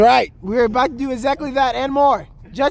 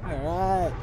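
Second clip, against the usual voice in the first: ordinary talk, nothing marked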